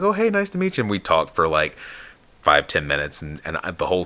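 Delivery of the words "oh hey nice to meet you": high, breezy imitated greeting; friendly greeting. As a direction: play a casual hello way up high. Retold greeting by cartoonist.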